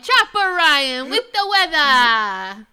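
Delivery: announcer toss. Acted. Host announcing the weather segment, announcer voice. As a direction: hand off to the weather like a news anchor.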